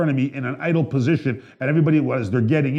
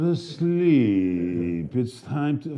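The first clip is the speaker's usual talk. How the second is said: lightly voiced soothing actor; a hint of a sleepy soothing drawl; Matthew McConaughey; 2 semitones higher, much slower than usual. Host imitating the actor's sleep tapes, lightly soothing. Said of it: "he goes".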